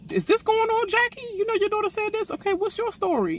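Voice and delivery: high mocking squeal of a castmate; high mocking castmate impression.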